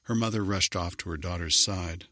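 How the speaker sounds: ordinary delivery, nothing marked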